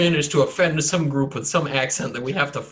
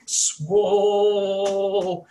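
higher, drawn-out mock awe; awestruck cry; 6 semitones higher than usual. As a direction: draw the word out in hammed-up wonder. Acting out a dream for a player.

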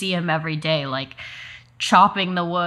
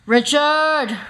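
put-on raised, high call for a missing boy; loud holler; 9 semitones higher, 5 dB louder than usual. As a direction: holler the name out the window. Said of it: "He hollers loudly".

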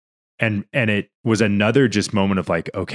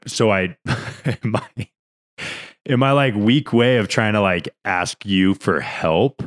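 normal talking voice

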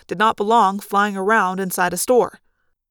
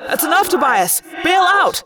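raised, high-pitched order to retreat; urgent yell; 7 semitones higher, 4 dB louder than usual. A narrator's reading. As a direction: yell a friend out of danger. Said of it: "I yelled".

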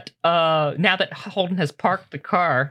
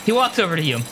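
usual speaking voice

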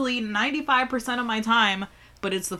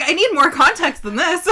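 higher and louder, pressing for answers; exasperated demand; 8 semitones higher, 7 dB louder than usual. Retold or imitated. Hearing about the thumb and pushing mom for the story, exasperated. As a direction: demand the details in disbelief. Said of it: "I was like".

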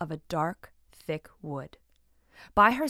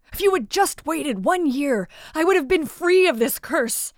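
higher, louder pained prince; anguished cursed man; 7 semitones higher, 5 dB louder than usual. Acted. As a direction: cry out the lost chance, distressed.

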